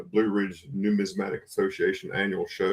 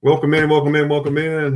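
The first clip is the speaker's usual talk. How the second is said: big loud welcome; 5 semitones higher, 8 dB louder than usual. Real, not acted. Streamer greeting viewers loudly.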